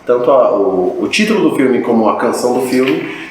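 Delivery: ordinary delivery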